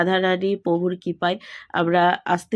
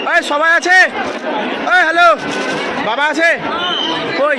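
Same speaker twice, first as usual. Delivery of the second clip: loud call across the temple; 9 semitones higher, 6 dB louder than usual. Real, not acted. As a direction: shout out to find family. Calling to family far off.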